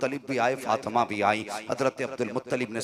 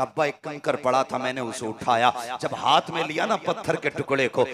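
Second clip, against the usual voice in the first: fervent preaching; 2 semitones higher, 10 dB louder than usual. Acted. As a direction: preach it with mounting fervour. Preaches at full force. (बढ़ते जोश के साथ तक़रीर)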